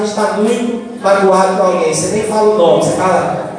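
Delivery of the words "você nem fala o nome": ordinary talk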